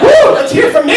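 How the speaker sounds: high, loud mock whoop; self-cheer. Real, not acted. Comic on stage cheering for himself, high and loud whoop. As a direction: cheer yourself on like a fan.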